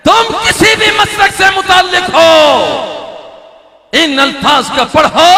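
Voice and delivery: high, loud call to the crowd; preaching shout. Acted. Preacher addressing every sect, shouting. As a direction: fling it out to the whole hall.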